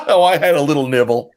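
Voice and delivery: high-pitched, giggly mock confession; laughing joke answer. Son answering his mother about eating, laughing mock confession. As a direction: play the punchline through a laugh.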